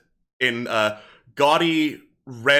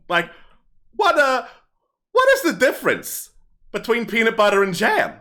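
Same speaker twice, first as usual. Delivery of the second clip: higher, livelier stage patter; kobold comedian; 6 semitones higher, 4 dB louder than usual. Acted. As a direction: work the crowd like a hammy stand-up comic. Kobold performs stand-up.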